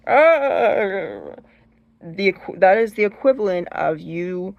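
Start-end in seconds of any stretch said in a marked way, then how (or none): 0.1-1.4 loud, drawn-out mock cry of fright; creeped-out cry. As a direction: recoil with a frightened wail. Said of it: "they do something creepy or move, and you're like".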